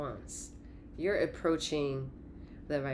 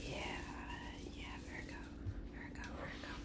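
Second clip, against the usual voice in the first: breathy whispered Virgo calls; 16 dB quieter, faster than usual. Acted. Reader whispering Virgo to the viewer, hushed. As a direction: whisper the name like a secret.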